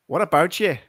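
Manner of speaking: shaky-skilled Northern Irish impression; attempted Northern Irish accent. Shown as a demonstration. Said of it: "in a Northern Irish accent".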